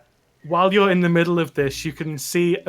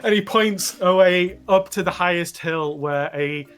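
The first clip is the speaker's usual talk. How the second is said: normal talking voice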